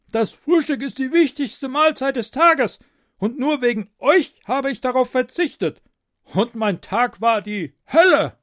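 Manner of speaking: high, loud complaining king; whining tyrant king. A narrator's reading. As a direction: complain like a spoiled monarch. King voiced by narrator.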